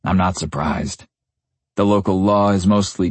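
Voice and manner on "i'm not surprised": usual speaking voice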